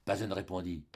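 usual speaking voice

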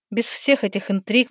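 plain reading voice

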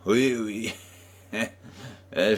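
amused, dismissive chuckle; starting to laugh. A narrator's reading. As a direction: chuckle between the words, disbelieving. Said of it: "Je me mis à rire".

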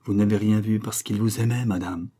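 usual speaking voice

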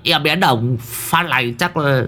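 put-on a touch of childish crying, understated; whiny, half-sobbing lament; wailing like a child. A narrator's reading. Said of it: "Đồng già thì khóc như trẻ con".

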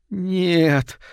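high, drawn-out moan of dismay. A narrator's reading. Mother hears the father's confession, high anguished moan. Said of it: "простонала мать".

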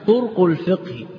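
طرق said turq with silent ra; طرق with the ra unvowelled, no vowel after it; طرق الفقه بإسكان الراء; sukun on the ra. Shown as a demonstration. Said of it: "بإسكان الراء".

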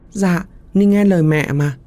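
put-on high sweet eerie child voice; ghost child. Ghost child promising mother to obey, high and sweet. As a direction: answer like an obedient little ghost.

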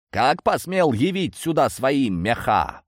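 high, slow indignant challenge; outraged demand. Acted. Old man confronts the cat at his house, outraged and slow. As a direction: thunder it theatrically, drawing out the words.

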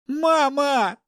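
high, loud cry for mother; frightened shout. A narrator's reading. Captive son calls to his mother, shouting. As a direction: call out at a high pitch. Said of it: "закричал Игорь".

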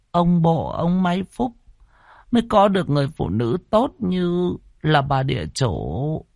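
put-on old midwife explaining to the grandson. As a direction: tell the hard truth gently. Old midwife voiced again.

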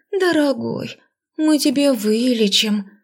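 high, slow sweet chirping; cartoon princess. A narrator's reading. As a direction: coo it like a sugary princess. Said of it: "удивительно ласково прощебетала".

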